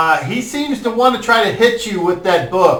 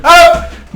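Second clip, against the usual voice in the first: loud high snorting huff; 12 semitones higher, 9 dB louder than usual. Acted. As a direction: huff back at him. Player joins the huffing.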